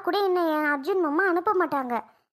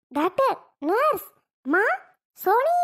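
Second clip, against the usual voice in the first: calling out for help; 2 semitones higher, much slower than usual. Acted.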